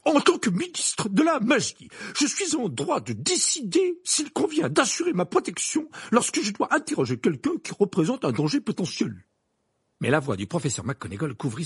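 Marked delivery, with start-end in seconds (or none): 0.0-9.2 high, loud indignant assertion; angry roar. A narrator's reading. Minister defends his right to protection, roaring angrily. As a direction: assert your rights with raised, pitched-up voice. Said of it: "jamais vu aussi en colère".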